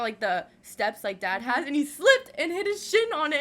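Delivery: ordinary talk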